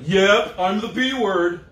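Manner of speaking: loud, high mocking whine, drawn out at the end; hammed-up sarcastic victim; sarcastic self-pity. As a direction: sneer it back, playing the martyr. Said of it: "Why are you acting that way?".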